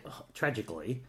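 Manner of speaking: ordinary delivery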